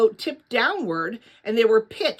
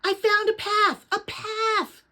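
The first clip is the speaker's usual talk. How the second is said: excited yell; 11 semitones higher, slower than usual. A narrator's reading. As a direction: call out the good news to him. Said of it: "she yelled again".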